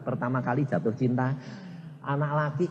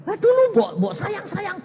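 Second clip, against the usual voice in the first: high teasing voice; 4 dB louder than usual. Retold or imitated. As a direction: tease him in a high voice. Pastor teases married man. (menggoda dengan suara tinggi)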